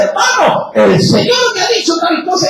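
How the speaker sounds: lightly hammed-up prophet voice; mock prophet's pronouncement. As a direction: ham up a preacher claiming a word from the Lord. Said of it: "te dice".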